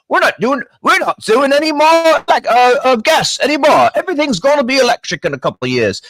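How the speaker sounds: a lightly voiced Cockney accent, pitched high; quoting Rolls-Royce in a hammed-up Cockney accent. Rolls-Royce announcement to the host, mimicked in a mock Cockney accent. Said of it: "Yes, that's my Cockney accent".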